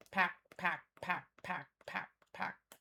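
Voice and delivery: mock echo trailing off to breath; fading echo. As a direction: echo that dies away into a whisper. Echoing her own word.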